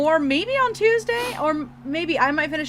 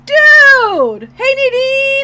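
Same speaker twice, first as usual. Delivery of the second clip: loud high shout of greeting; excited call-out; 9 semitones higher, 10 dB louder, much slower than usual. Real, not acted. Friend spotting Nadine, excited shout. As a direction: call out to a friend across the room.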